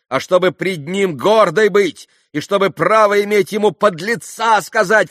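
high, loud heated tirade; yelling. A narrator's reading. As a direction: pour it out at full voice, indignant. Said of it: "вопила Грушенька". (гневная тирада, на крике)

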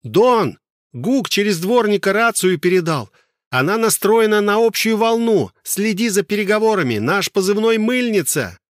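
put-on high, loud girl's voice; shouting over engine noise. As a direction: shout it right into his ear. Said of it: "крикнула почти в самое ухо".

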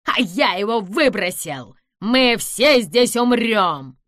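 slow loud doom-laden cry; gloating doom. A narrator's reading. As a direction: proclaim death for all. Villain gloats about doom.

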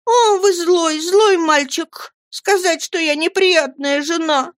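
put-on high, wailing childish reproach; tearful outcry. A narrator's reading. Dora bursts into tears at husband, high outcry. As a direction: cry out high, stretching the wail. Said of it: "закричала Дора, заливаясь слезами".

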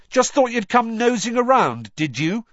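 high, loud accusing yell; angry shout. Acted. Harry lashing out at Ron, shouted. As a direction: shout it at him. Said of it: "Harry shouted".

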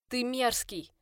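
loud growling insult; growled warning. A narrator's reading. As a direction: spit it out with a snarl. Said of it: "прорычала я".

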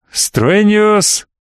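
slow, high ceremonial intoning; solemn proclamation. A narrator's reading. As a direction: intone it like an emperor. Emperor names virtue.

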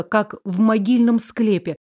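ordinary delivery

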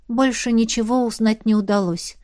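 ordinary delivery, nothing marked

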